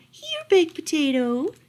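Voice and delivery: high sing-out call to a pet; calling the dog. A narrator's reading. Lady through the days, calling for her dog. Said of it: "she called for him".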